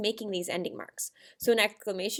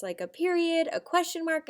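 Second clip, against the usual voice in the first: usual speaking voice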